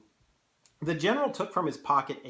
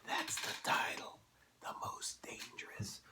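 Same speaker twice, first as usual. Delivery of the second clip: whispered reveal to the class; hushed, voiceless aside; dramatic whisper; 8 dB quieter than usual. Real, not acted. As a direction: whisper it like a discovery. Teacher stops to point out title.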